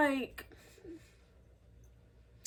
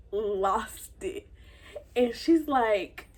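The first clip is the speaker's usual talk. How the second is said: normal talking voice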